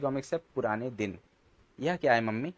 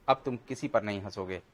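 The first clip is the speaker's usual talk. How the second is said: ordinary delivery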